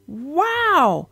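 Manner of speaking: gentle read-aloud for children, voicing each animal; story narration. A narrator's reading. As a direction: tell it like a bedtime storybook.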